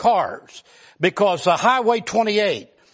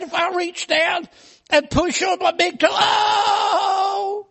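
high, tearful whine about pain; whimpering hypochondriac; 11 semitones higher, slower than usual. Retold or imitated. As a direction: whine like every touch is agony. Arzy moaning in pain.

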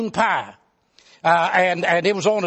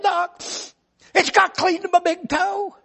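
high, tearful whine about pain; whimpering hypochondriac; 10 semitones higher than usual. Retold or imitated. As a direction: whine like every touch is agony. Arzy complaining.

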